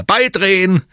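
high, loud sailing commands; high-pitched bellowing; bellowed order. A narrator's reading. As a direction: shout urgent orders across the deck. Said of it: "brüllt Oliver".

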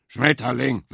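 despairing boar groans Schmetterling; groaning boar. A narrator's reading. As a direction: let Schmetterling sink in a miserable groan. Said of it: "stöhnte Wildschwein".

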